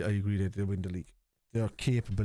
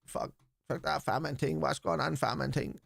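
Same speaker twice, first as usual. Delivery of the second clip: higher-pitched hammed-up London fan-channel voice; mock London slang; 5 semitones higher than usual. Mimicking what viewers will hear on those channels, mock London slang. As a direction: put on a cartoonish London street accent. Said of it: "All you're gonna be hearing is fucking".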